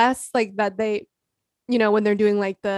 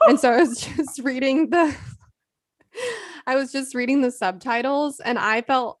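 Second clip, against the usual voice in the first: ordinary talk, nothing marked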